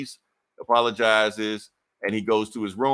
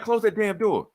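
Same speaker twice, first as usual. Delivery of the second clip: much higher, strained snap from a man pinned down; grumpy Thor; 11 semitones higher than usual. Retold or imitated. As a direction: squeak it out, pinned under a hammer. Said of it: "Thor's like".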